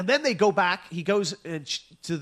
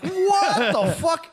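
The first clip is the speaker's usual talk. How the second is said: much higher, slow, laughing through it; laughing disbelief; 13 semitones higher, 6 dB louder, much slower than usual. Retold or imitated. Date hearing the crude request, slow laughing disbelief. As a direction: stretch it out laughing in shock. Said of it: "You're like".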